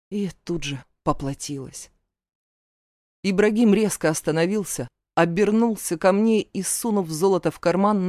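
ordinary delivery, nothing marked

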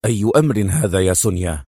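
low, guarded question; wary, stern. A narrator's reading. Adham probes Sonia's motive, cautious and stern. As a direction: ask firmly but warily, pitch dropped. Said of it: "سألها في حذر صارم".

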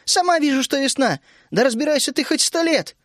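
put-on girl snapping back mid-quarrel; angry snap. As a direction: cut him off in exasperation. Vishnya snaps during quarrel.

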